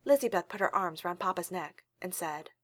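plain reading voice

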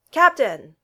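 loud call upward. A narrator's reading. Thor calling up to Steve, loud hail. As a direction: hail him from below.

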